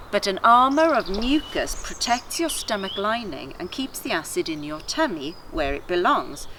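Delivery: usual speaking voice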